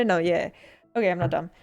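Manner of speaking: ordinary talk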